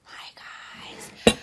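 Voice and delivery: breathy whispered greeting; ASMR whisper. Acted. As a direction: whisper softly into the mic.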